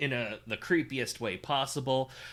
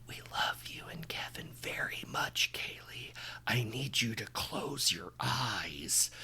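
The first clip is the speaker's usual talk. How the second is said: soft unvoiced whisper; 2 semitones lower, 5 dB quieter than usual. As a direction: breathe it like a worried mother. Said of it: "so she starts whispering".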